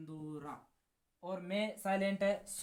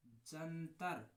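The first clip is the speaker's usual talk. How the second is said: slow, stretched syllables; drawn-out name; 2 semitones lower, 7 dB quieter, much slower than usual. Shown as a demonstration. Teacher drilling students on an instrument name, slowly drawn out. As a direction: spell the name out slowly for the class. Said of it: "कौन सा वाद्य यंत्र?".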